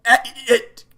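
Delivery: high, loud come again; shocked disbelief. Real, not acted. Player to the game, high and stunned. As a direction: blurt it out stunned.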